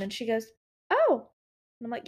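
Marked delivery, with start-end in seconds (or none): 0.9-1.3 high-pitched mock sceptical "oh"; imitated doubtful reaction. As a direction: imitate a tech doubting the choice. Retelling the tech's doubtful reaction.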